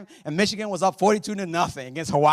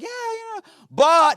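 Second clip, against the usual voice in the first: hedging mimic; 9 dB louder than usual. People hedging before the but.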